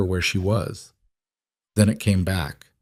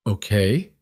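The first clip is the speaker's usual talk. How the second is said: ordinary delivery, nothing marked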